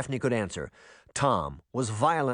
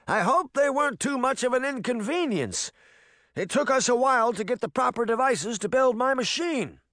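put-on old man voice; 11 semitones higher than usual. A narrator's reading. Tom voiced as character.

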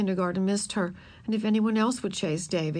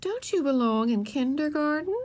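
higher-pitched questioning teacher; teacher asking; 7 semitones higher than usual. A narrator's reading. Yard teacher to Ramona by the cans, questioning. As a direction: ask pointedly, rising at the end. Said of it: "the yard teacher asked".

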